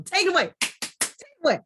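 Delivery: high, loud, eager cue to begin; excited handoff. Real, not acted. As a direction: hand the mic over with a burst of energy. Host hands over to guest.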